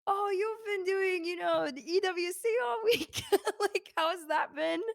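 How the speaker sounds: high, friendly imitation of the prince, laughing through it; imitating the prince. The prince asking how her week went, high laughing imitation. She voices the prince chatting.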